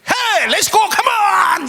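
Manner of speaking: high, loud cheering yell from the coaching box; rally the batters with a big shout. Retold or imitated. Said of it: "이 얘기했어요".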